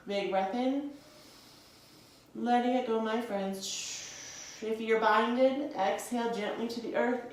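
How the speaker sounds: slow, soothing instructor cues timed to the breath; guided yoga voice. Acted. Yoga teacher guiding students through poses, soothing. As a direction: talk the class through each breath calmly.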